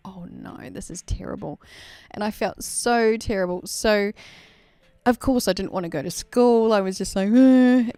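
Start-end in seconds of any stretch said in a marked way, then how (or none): 0.1-1.6 hushed, dismayed whisper; guilty whisper. Retold or imitated. Recalling her guilt as a child, a dismayed whisper. Inner panic, guilt at nine. 7.3-7.9 loud groan. Retold or imitated. Not wanting to go to school.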